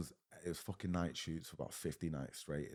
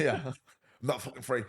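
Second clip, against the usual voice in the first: mock indignant; 8 semitones higher, 8 dB louder than usual. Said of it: "people would be like".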